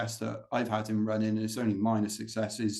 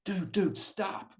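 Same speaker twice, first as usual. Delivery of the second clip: higher-pitched put-on American accent; 9 semitones higher, much slower than usual. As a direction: imitate an American pacer's urgent warning. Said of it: "apologies on my American accent".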